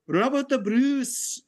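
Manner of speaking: high, slow Scottish proclamation; drawn-out Scottish accent. Shown as a demonstration. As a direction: roll it out in a grand Scottish accent. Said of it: "I can do with a Scottish accent".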